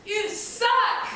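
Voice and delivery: high, loud heckle; retold yell. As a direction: shout it at the stage. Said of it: "she starts yelling".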